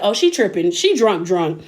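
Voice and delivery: put-on dry, unbothered teen shrug; sarcastic dismissive. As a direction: brush it off, mildly sarcastic. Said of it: "the energy and the inflections in his voice".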